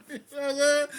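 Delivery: high laughing and talking; high, loud laughing bursts. Real, not acted. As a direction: laugh through the question. Laughs at own emotional confession.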